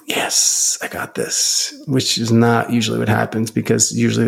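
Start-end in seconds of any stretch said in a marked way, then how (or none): 0.1-1.7 a hushed, breathy little victory; whispered triumph. Pretend. Imagined client whispering his win at the episode's end.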